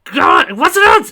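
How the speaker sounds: very high, loud exclamation; exclaiming. Acted. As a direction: shout it in alarm. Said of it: "Gamark exclaimed".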